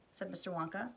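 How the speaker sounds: plain reading voice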